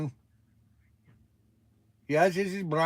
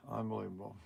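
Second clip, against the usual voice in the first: very soft, low weary mutter; muttered disbelief; 8 semitones lower, 15 dB quieter, much faster than usual. Real, not acted. After the phone call ends, very soft weary mutter. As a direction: shake the head and breathe out Unbelievable.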